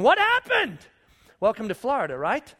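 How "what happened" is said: high, loud startled question; bewildered. Retold or imitated. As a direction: cry out in sudden confusion.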